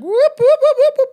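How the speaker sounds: very high, loud whooping call; Bigfoot call imitation. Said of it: "Bigfoot has a call".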